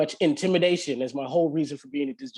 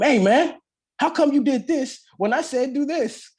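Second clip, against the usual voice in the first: intimidating bark; 8 semitones higher, 4 dB louder than usual. Retold or imitated. Trying to intimidate the man.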